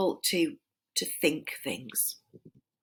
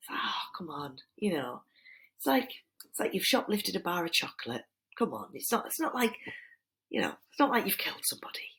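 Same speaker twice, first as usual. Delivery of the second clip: whisper; 4 dB quieter than usual. Real, not acted. Coaxing aside to students.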